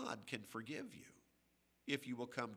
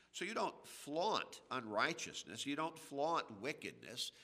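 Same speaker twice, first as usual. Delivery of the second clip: normal talking voice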